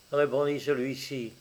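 plain reading voice

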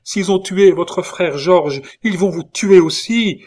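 high, loud, slow cry of alarm; frightened cry. A narrator's reading. Catherine fearing her lover will be killed, crying out high and loud. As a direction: cry out in terror for him. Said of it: "s'écria-t-elle".

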